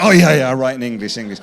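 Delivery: loud, rushed burst of sudden agreement; quick, loud retold change of heart; eager agreement. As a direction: fall over yourself agreeing, mock-eager. Retells publishers suddenly agreeing for money.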